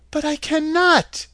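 high, startled cry of protest; surprised little girl. A narrator's reading. Dorothy shocked by the wizard's demand, high and surprised. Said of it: "greatly surprised".

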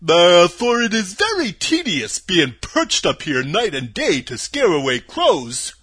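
loud, slow, husky scarecrow; scarecrow character. Acted. Scarecrow tired of being perched up there, husky. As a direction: complain in a big, drawn-out husky voice.

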